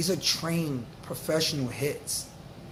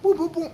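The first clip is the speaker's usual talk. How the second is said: higher, louder mock knocking; imitated banging; 13 semitones higher, 4 dB louder, faster than usual. Neighbors pounding on the ceiling, loud mock knocks. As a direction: knock it out with your voice. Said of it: "The neighbors banging on the top".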